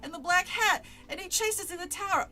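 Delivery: put-on urgent kid. A narrator's reading. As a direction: pipe up like an alarmed kid. Child explaining urgently to the guard.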